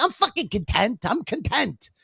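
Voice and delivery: high-pitched indignant insistence; exasperated re-enacted protest; retold anger. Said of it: "you made me angry".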